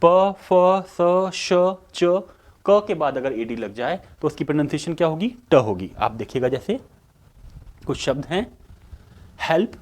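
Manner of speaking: ordinary talk, nothing marked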